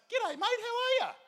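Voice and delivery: loud, very high Aussie greeting; unexpectedly chirpy God. Acted. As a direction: squeak out a comic, matey hello as God. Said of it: "God turns around and he goes".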